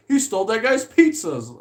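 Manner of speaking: high-pitched quoted exclamation; a lighter imitation of a shocked passerby; mock bystander outcry. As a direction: call it out high, like a movie quote.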